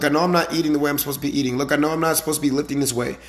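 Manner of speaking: usual speaking voice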